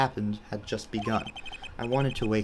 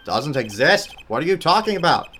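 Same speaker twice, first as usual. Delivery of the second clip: loud, higher shocked judge; shocked outburst; 6 semitones higher, 8 dB louder than usual. Acted. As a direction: blurt it out in disbelief.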